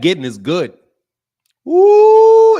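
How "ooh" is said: a sudden high, loud ooh. Real, not acted. Streamer squealing at his own joke, high and loud. Reacting to getting is good.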